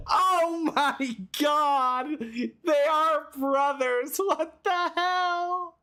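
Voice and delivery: high, slow, stunned disbelief; shocked surprise. Real, not acted. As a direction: gasp it out in total shock. Reacting to game reveal.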